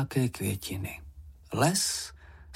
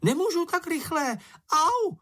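very high squealed protest, ending in a yelp; calling out. A narrator's reading. Čenich is dragged along by the rope, squealing cry. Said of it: "zvolal Čenich".

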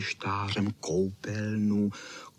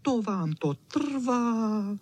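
high, drawn-out grumble; grumbling; 12 semitones higher, much slower than usual. Acted. Čau Čau grumbles at the slow grape pickers, high and impatient. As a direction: complain in a high, stretched-out dog voice. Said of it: "vrčel Č".

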